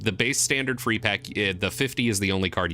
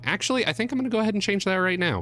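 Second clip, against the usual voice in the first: much higher and fast, rushing the words out; sudden excited decision; 9 semitones higher, much faster than usual. Real, not acted. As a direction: blurt it out on a sudden impulse. Sudden high fast burst.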